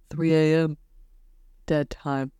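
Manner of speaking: spooky TV host dragging out the words; creepy host voice. Retold or imitated. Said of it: "would say it in the creepiest voice".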